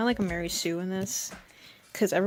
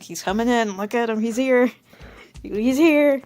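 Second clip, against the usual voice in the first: building to a high, loud cheer; excited announcement; 3 semitones higher, 7 dB louder than usual. Acted. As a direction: hype up his big entrance, louder each time. Hyping up Henry's entrance.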